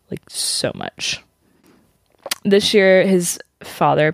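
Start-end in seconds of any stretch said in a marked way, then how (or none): 0.1-1.2 breathy whisper, drawn out slowly; whispered emphasis. Real, not acted. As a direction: lean in and whisper it, awed. Stresses his hidden charity work.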